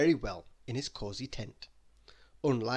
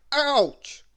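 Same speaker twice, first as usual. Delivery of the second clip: high, loud yelp of pain; a sharp cry from a hammered thumb; loud ouch; 12 semitones higher, 12 dB louder than usual. Acted. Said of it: "As Mister Bump hit his thumb".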